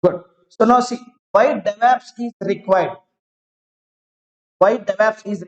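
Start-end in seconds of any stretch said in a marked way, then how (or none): none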